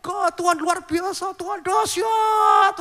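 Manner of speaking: high, slow, straining shouted sermon; shouting preacher. Retold or imitated. Pastor reenacting his own overblown sermon, high straining shout. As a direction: overdo it, yelling like a revivalist. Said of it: "saya teriak-teriak".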